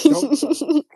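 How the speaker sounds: high mock-stern squeal; playful scolding. Real, not acted.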